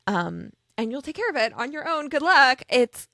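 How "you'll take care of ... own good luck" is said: higher, airy brush-off ending loud; mock send-off. Showing what Lo won't say to Garrison, mock dismissive send-off. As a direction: toss off a hollow good luck.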